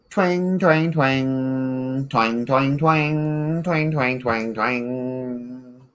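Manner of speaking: high plucked twang sounds; twang noises. Acted. Showing friend how the toy goes, high plucky twangs. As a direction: pluck each twang like a string. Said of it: "And it goes".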